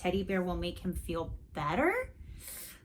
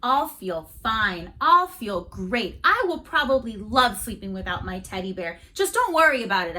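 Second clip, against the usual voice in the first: put-on defiant boy; 2 semitones higher, 8 dB louder than usual. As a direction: overdo the confidence to hide doubt. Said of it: "I said".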